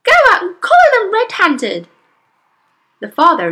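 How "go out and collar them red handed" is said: urgent, bossy. Acted. Mother urging husband.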